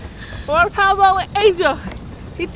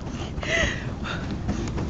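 breathless laugh; 12 semitones higher, 14 dB quieter than usual. Real, not acted. Laughing after tiring pedal ride.

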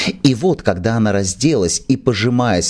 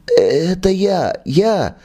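trembling whisper; 9 semitones higher, much slower than usual. Acted. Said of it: "дрожащий шёпот".

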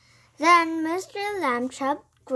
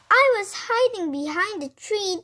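higher, lightly squeaky storybook voice; a touch of a scared boy's squeak; 7 semitones higher than usual. A narrator's reading. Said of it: "Tom squeaked".

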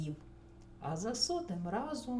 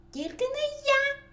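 very high, loud squeal; screeching mouse; 9 dB louder than usual. A narrator's reading. Second mouse refuses the chore too, screeching. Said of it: "заверещав Верть".

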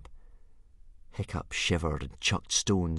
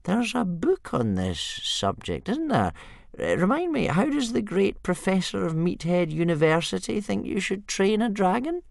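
put-on sly old man; 4 dB louder than usual. Old Wrinkly to Hiccup, high and sly. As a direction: tease the professor's advice.

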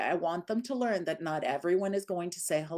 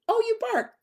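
high, sing-out dog-trainer voice; airy mock surprise; 4 dB louder than usual. Shown as a demonstration. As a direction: pitch it way up, breezily dismissing the dog. Demonstrates brisk response to barking dog.

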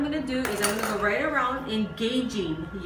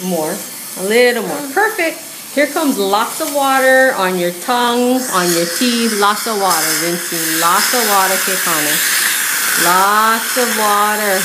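loud; 12 dB louder than usual. Real, not acted. Orthodontist calling over suction noise.

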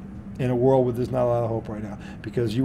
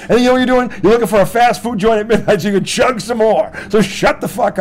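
loud, high rant; full-volume rant; ranting shout; 7 semitones higher, 5 dB louder than usual. Real, not acted. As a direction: yell it at the drinkers. Rant continues.

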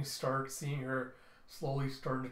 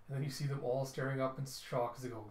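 usual speaking voice

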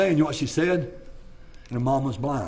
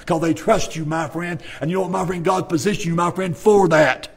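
higher, louder, stretched preaching with a music bed; preaching at full force; 5 semitones higher, 4 dB louder than usual. Acted. Mountain preacher urging young mothers, full-force preaching. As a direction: preach it hard and rising to the amen.

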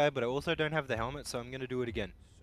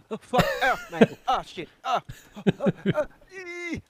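yelping; 11 semitones higher, 9 dB louder than usual. Real, not acted. Character falls, cries out.

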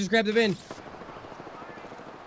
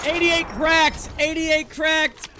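excited callout; 8 semitones higher, 4 dB louder, slower than usual. Real, not acted. Shield break callout.

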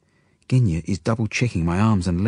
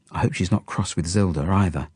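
ordinary delivery

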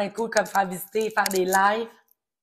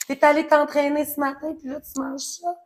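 higher, louder mock of a nosy coworker; 7 semitones higher, 6 dB louder than usual. Coworker commenting on her lunch after the gym, mocking. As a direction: play the judgy colleague at lunch. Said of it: "un esti qui".

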